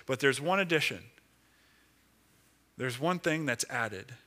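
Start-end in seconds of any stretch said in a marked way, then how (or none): none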